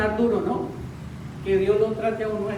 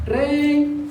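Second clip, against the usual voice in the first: woman calling her horse; 4 semitones higher than usual. Retold or imitated. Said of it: "y la señora lo llama".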